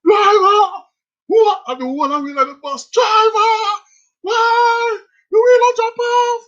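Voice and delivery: high frantic screaming call; screaming girls. Retold or imitated. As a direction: scream for the driver in panic. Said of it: "the wheel just start screaming to us".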